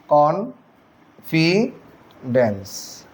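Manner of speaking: very slow, each syllable of confidence stretched apart; syllables drawn out. Shown as a demonstration. Teacher showing students the syllables of confidence, very slowly. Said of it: "উচ্চারণটা খেয়াল করেন".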